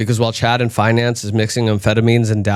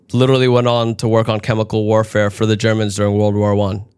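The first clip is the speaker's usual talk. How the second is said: usual speaking voice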